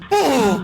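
short high loud shriek; scared scream. Real, not acted. Gamer shrieks when the monster grabs him. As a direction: yelp in fright. Caught in the dark.

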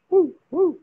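high, loud woo; whooping cheer. Real, not acted. Cheering at the gathering with a loud whoop.

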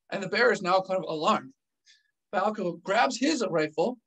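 ordinary delivery

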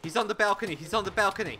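urgent callout. Real, not acted. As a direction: yell it to warn the squad. Spotting enemy in firefight.

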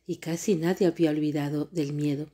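ordinary delivery

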